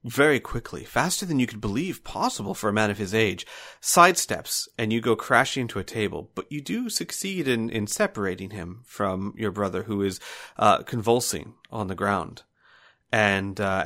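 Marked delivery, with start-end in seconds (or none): none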